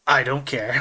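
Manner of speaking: ordinary talk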